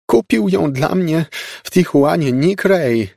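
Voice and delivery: wysoki, podniesiony głos ciotki; teatralny lament. A narrator's reading. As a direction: lamentuj z przesadą, bardzo wysoko. Aunt laments her broken pot. (teatralne użalanie się ciotki)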